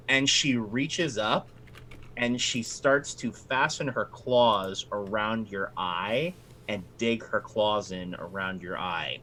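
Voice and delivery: ordinary talk, nothing marked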